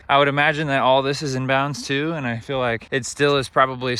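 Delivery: winded from climbing the hill; huffing between words after a climb; out of breath. Real, not acted. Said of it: "sorry, I'm out of breath".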